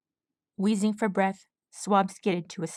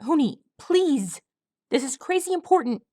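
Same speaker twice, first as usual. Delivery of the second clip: pleading colt; 7 semitones higher, 3 dB louder than usual. A narrator's reading. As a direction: beg him like a desperate kid. Swab pleading.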